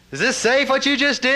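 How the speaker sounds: high, loud shout over thunderous noise; retold scream. Master screaming at student over the waterfall, retold shout. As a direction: yell the question across a roaring waterfall. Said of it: "the master screams at me".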